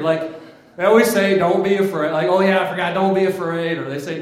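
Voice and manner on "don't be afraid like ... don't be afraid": loud, higher, hasty afterthought; comic angel calling out a belated reassurance; blurted angel reassurance. Acted. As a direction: blurt it out as if you nearly forgot.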